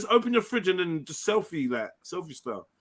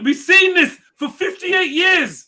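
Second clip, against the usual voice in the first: mock exasperated shout; 11 semitones higher, 8 dB louder, slower than usual. Mocking friend on stream.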